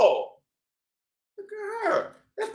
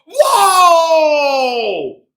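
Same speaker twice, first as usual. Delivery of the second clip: loud drawn-out whoa; hammed-up fright; mock scare; 2 semitones higher, 8 dB louder than usual. Scarecrow reveal.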